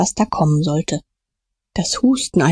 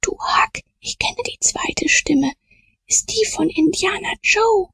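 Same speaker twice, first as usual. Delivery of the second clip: high, half-whispered discovery; hushed, higher-pitched urgency; alarmed hush; 13 semitones higher than usual. Acted. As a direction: whisper your alarmed recognition to a friend. Hiding boy recognises dangerous voice.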